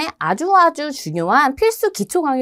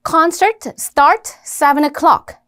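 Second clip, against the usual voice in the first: very slow, each content word spaced out; bare keyword string, dragged word by word; content words only; 3 semitones higher, much slower than usual. Shown as a demonstration. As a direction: read out only the key words, slowly. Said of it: "요런 내용어가 있죠".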